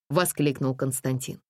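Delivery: ordinary delivery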